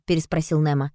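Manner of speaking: usual speaking voice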